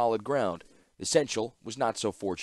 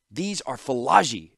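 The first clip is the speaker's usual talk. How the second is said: slow, louder insistent shout; 4 semitones higher, 7 dB louder than usual. Acted. Tawnos warning Ashnod of the attackers, slow loud shout. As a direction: hammer it home. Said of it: "shouted Tawnos".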